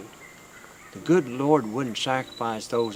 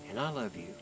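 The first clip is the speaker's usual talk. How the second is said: tender; 7 dB quieter than usual. Acted. Grandfather comforting suicidal grandson.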